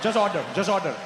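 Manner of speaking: put-on restrained Indian-accented insistence; tense but contained fake Indian accent; controlled urging. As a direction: press him firmly without shouting, Indian accent. Urging friend, still in put-on accent.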